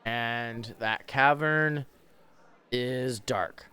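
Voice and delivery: slow, measured scene setting; game master narration. Acted. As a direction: set the dungeon scene slowly.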